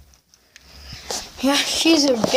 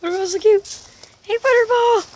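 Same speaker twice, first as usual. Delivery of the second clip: pet talk; 7 semitones higher than usual. Real, not acted. Calling to the cat.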